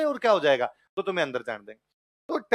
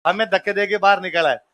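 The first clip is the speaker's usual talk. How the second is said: laughing joke. Pretend. Joking plan, laughing loudly.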